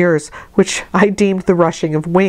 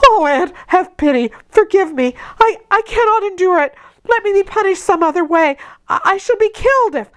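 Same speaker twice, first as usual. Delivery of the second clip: much higher, fast, desperate begging; frantic pleading; 13 semitones higher than usual. A narrator's reading. Terrified girl begging her aunt for pity, frantic and high. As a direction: beg the aunt for mercy in panic. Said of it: "frantic anguish and wild sobs".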